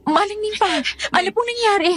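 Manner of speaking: high, worried questioning; alarmed. Acted. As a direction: ask urgently what is wrong. Alarmed question about the commotion.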